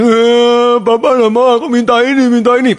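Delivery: high mock-wailing kid; crying child. Child crying at papa for a toy, mock wail. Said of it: "pada waktu anaknya nangis".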